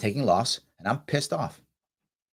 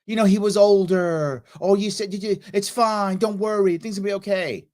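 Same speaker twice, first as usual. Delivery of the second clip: mock cheer-up friend; 8 semitones higher, 5 dB louder than usual. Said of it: "She goes".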